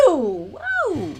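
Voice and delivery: high surprised whoa after a sneeze; startled whoa. Real, not acted.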